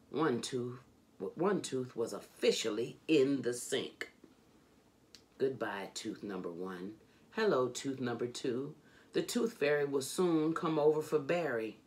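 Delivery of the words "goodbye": ordinary delivery, nothing marked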